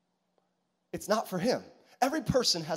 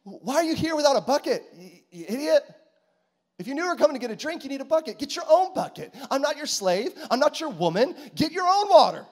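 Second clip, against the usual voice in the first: higher and louder, building to a hammed-up yell; mock angry woman; 9 semitones higher, 7 dB louder than usual. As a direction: ham up her rage, louder to the end. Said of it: "That's her response to Jesus".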